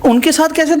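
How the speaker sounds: incredulous echo. Real, not acted. Host throws husband's words back, incredulous. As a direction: turn his own words back on him, disbelieving.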